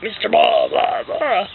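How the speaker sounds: put-on, acted-out blahs; video clip version. Shown as a demonstration. Author imagines book playing the real clip, put-on voice. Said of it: "it'll be like".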